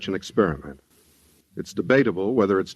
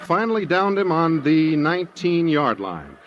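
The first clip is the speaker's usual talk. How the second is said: brisk, raised stadium announcer voice, pitched higher; live football play-by-play; 7 semitones higher than usual. Acted. Johnny Scott announcing the Gridiron Classic to his listeners, lively play-by-play. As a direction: call the game like a radio sportscaster on air.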